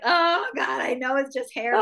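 high, loud exasperated groan; exasperated mom. Retold or imitated. Mother watching her messy-haired daughter leave the car, high and exasperated. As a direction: throw up your hands, high and exasperated. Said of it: "and I'm like".

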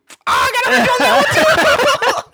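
high, drawn-out gloating shout; mock boastful yell. Friend mocking the test show-off, gloating yell. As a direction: yell it way up high, stretched out and laughing.

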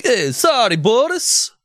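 high, mock-sorry mobster; sing-song fake apology to Boris. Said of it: "And then it's like".

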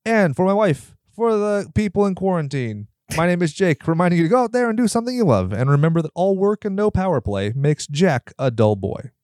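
high, quick radio sign-off; sign-off announcer voice. Acted. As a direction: deliver the outro like a host. Podcast sign-off catchphrase.

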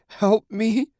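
high-pitched spluttering cry for help; urgent high plea from someone half-drowned; spluttered plea. A narrator's reading. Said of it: "the woman spluttered".

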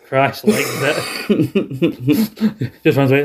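ordinary delivery

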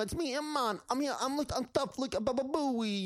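very high squeaky tough-guy imp; Impmon bragging. Retold or imitated. Co-host voicing Impmon boasting he's tough, high squeaky. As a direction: puff up like a tiny tough imp.

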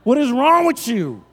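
high, loud, drawn-out disbelief; mock outrage. Pastor scolding Ohio pizza eaters, mock outrage. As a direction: scold them in pretend horror.

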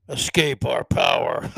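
low, loud cartoon supervillain; mock villain. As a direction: boom it out like a power-mad villain. Voices Schwab as movie villain.

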